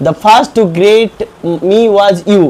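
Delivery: slow, loud English read for the class; slow classroom reading. Shown as a demonstration. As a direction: read each word out slowly and clearly. Teacher reads English text aloud.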